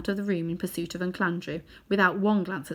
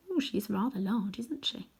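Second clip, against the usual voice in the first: hushed aside; 2 semitones higher, 6 dB quieter than usual. A narrator's reading. Sly narrator aside.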